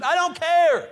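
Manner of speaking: loud, high, emphatic refusal; forceful shout. Real, not acted. Preacher to the congregation, a loud emphatic refusal. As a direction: throw it back hard.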